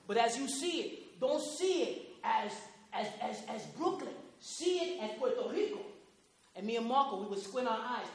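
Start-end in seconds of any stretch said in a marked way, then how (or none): none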